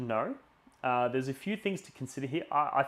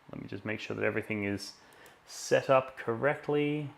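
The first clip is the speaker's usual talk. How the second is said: ordinary talk, nothing marked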